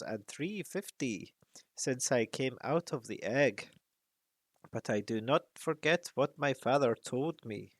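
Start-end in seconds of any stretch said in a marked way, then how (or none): none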